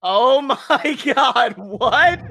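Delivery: high, loud, stunned disbelief; shocked laugh. Real, not acted. Stunned reaction to Captain Crunch.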